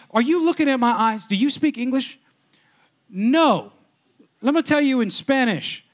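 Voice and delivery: high, mock-stern refusal; exasperated dad. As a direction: lay down the law to a pestering kid, hammed-up. Dad refuses the begging son.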